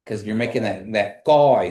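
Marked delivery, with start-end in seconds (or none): 1.2-1.7 "guy" said loud with the G at the back of the throat; "guy" pronunciation demo. Shown as a demonstration. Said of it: "sound in the back of your throat".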